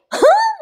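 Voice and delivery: high-pitched giggle; short, high burst of laughter; amused laugh. Real, not acted. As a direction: giggle with delight. Amused by the scene recapped.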